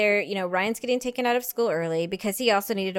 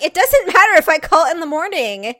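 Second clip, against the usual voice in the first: exasperated; 9 semitones higher, 10 dB louder than usual. Retold or imitated. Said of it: "I'm like".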